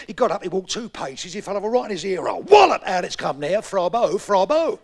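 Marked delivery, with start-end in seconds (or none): 2.4-2.8 high loud shouted Wallop; comic yell Wallop. Retold or imitated. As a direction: shout the crash as a sound effect. Comic fall sound.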